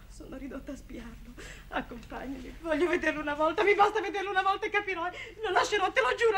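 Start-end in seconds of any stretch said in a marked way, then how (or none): 2.6-6.4 higher, louder, fast, breaking with tears; rising, tearful desperation; desperate plea. Acted. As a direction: beg him, half laughing, half crying.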